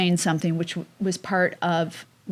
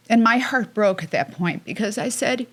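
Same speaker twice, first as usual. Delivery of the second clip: ordinary talk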